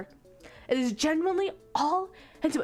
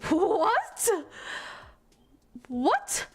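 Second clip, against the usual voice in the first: stunned, drawn-out questioning with a steep rise; baffled, sharply rising disbelief; incredulous; 3 semitones higher, much slower than usual. Real, not acted. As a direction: react in utter disbelief. Stunned reaction to the plot.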